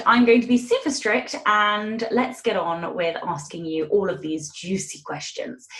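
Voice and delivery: usual speaking voice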